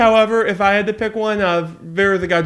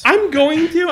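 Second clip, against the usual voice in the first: higher defensive protest; 10 semitones higher, 3 dB louder than usual. Real, not acted. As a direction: insist defensively. Defensive repeated reply.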